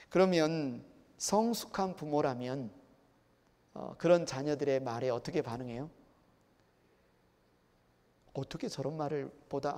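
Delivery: normal talking voice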